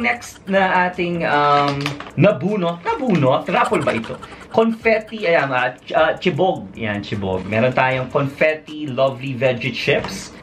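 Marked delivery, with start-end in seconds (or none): none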